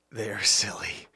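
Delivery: whispered with a laugh. Real, not acted. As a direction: whisper it like a secret, giggling. Teasing reveal.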